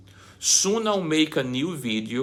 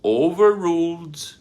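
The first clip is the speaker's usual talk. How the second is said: a high, loud ruling from the bench; stern judge; 8 semitones higher, 5 dB louder than usual. Acted. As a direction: snap down the ruling like an irritated judge. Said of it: "And the judge says".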